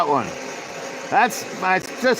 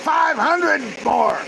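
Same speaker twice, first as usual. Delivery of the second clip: shouting; 7 semitones higher than usual. Pretend. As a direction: shout it at the screen. Heckling a speech, shouting.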